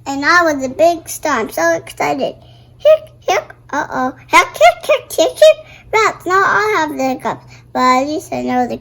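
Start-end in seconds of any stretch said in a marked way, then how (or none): 2.8-3.5 two high hiccups; squeaky hiccups. Acted. Girl catching the hiccups after the show, high hiccups. 4.3-5.7 giggly hiccups. Acted. Girl with hiccups again, high giggly run of hiccups.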